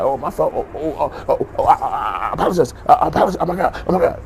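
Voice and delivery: very high, loud, stammering mock panic; hammed-up squealing "so sorry" act; mock grovelling apology. Offender apologizing for a slur, hammed-up frantic squeal.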